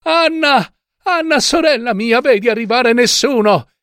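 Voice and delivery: high, urgent, anxious call; supposedly stifled voice. A narrator's reading. Wife secretly asks her sister on the tower, urgent. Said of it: "riprese con voce soffocata".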